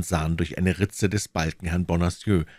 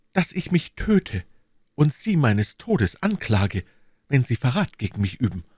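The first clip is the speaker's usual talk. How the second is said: higher-pitched, measured female character voice; young woman laying down a solemn warning; 10 semitones higher, 3 dB louder than usual. Acted. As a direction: swear it gravely before God.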